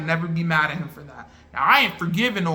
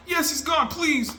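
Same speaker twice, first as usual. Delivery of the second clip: much higher shouted cheer and plea; excited shout; 11 semitones higher than usual. Retold or imitated. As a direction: yell it out, begging him to stay gone. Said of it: "I'm going back and forth".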